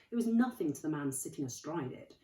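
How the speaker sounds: ordinary delivery, nothing marked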